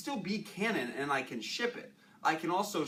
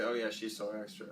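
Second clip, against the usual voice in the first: low, softer, offhand remark; lower, understated take on "so extra"; neutral connotation; 6 semitones lower, 3 dB quieter than usual. Shown as a demonstration. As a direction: say it low, without much weight on "extra". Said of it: "depends on the connotation".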